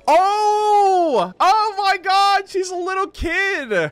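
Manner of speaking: high, loud shocked cry of surprise; shocked outcry. Real, not acted. Streamer reacting to a game cutscene, real shock. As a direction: gasp out in real shock at the reveal.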